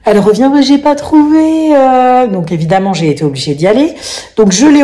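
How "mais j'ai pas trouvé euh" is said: put-on high, drawn-out teen whine; whiny teen excuse. Mother imitating her daughter's whiny excuse. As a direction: mimic the daughter's lame excuse.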